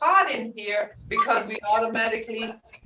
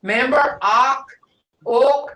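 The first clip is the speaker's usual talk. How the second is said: slow teaching delivery; 5 dB louder, slower than usual. Shown as a demonstration. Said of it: "Sakjahne means poured in".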